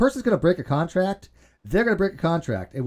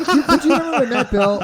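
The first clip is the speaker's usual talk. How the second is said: laughing; 12 semitones higher, 6 dB louder than usual. Real, not acted. Laughing while recalling Matt Bell.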